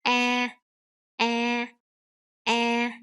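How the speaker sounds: AH held on a level tone. Shown as a demonstration. Teacher models the first vowel sound, AH held level. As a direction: say AH evenly, with no rise. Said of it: "So that is the rising tones".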